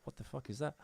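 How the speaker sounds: ordinary talk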